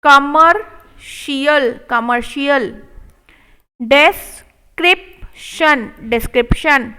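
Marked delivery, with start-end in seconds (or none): 0.0-1.8 commercial drawn out slowly; slow model take. Shown as a demonstration. Teacher modelling commercial slowly. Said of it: "slow model take". 3.8-5.9 description stretched slowly; slow model take. Shown as a demonstration. Teacher modelling description slowly. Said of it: "slow model take".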